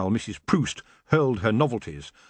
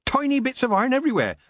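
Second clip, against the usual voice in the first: rattled off fast; 13 semitones higher, faster than usual. Acted. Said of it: "I stress".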